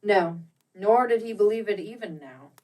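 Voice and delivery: ordinary delivery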